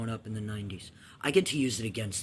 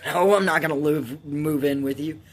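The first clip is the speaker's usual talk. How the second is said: put-on loud, flat-out no from a boy; firm refusal; 4 semitones higher, 10 dB louder than usual. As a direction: shut her down hard. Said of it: "Ray's like".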